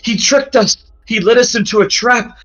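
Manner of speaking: high, desperate voice pointing out the traitor; Dolores accusing. Acted. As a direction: raise the pitch and accuse him in anguish.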